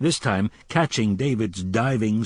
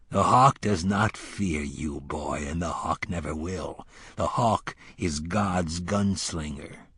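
low, slow, blunt remark; deadpan put-down; dry gruff instructor; 5 semitones lower, slower than usual. A narrator's reading. Cort to wounded Roland, low and blunt.

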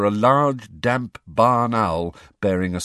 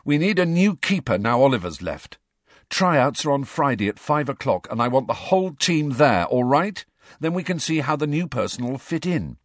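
higher-pitched, businesslike team captain; keen captain giving instructions; Angelina as brisk new captain; 8 semitones higher, 3 dB louder than usual. A narrator's reading. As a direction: rattle off team orders with authority.

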